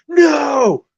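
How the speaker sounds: high, loud mock yell of a bossy staffer; retold yell. Podcast guy running up to stop the autograph, a high loud mock yell. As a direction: bark it out like a guard shooing a fan. Retells a staffer yelling at him.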